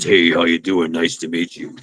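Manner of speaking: a low calm American tough guy; deep tough guy. Retold or imitated. Guest contrasts American masculinity with a low, quiet macho voice. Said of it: "our idea of masculinity is".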